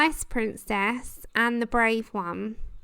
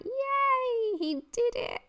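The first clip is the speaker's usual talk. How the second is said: high squeal of joy, mock celebration; excited cheer; 12 semitones higher, much slower than usual. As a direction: squeal it like a thrilled kid. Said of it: "I'm like".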